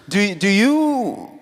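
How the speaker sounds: normal talking voice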